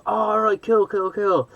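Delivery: high-pitched mock of a breezy reply; clueless American guy. Shop guy corrected about Ireland, imitated breezy acceptance. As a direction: imitate him, voice way up.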